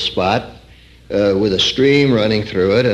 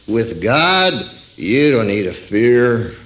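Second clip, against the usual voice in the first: ordinary delivery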